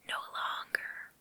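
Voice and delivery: usual speaking voice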